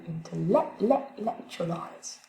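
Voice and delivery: "intellectualize" said very slowly, stress on "-lec-"; slow stress demo. Shown as a demonstration. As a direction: drag out "intellectualize", leaning on "-lec-". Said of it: "l'accent principal, il est juste avant le U A L". ("intellectualize" très lentement, accent sur "-lec-")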